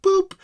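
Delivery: high, drawn-out boop; imitated scanner beep. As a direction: mimic an electronic scanner.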